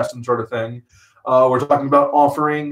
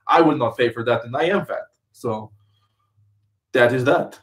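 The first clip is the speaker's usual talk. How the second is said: light mock Russian accent; hint of a Russian accent, deadpan. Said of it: "talking in Russian accent".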